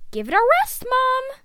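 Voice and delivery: put-on loud, high teenage protest; exasperated. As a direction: whine it loudly at mom. Retake, son exasperated with mother.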